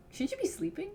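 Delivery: normal talking voice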